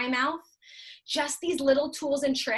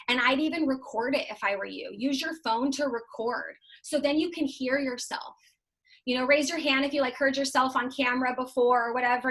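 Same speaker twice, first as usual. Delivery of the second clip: normal talking voice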